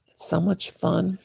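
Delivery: ordinary talk, nothing marked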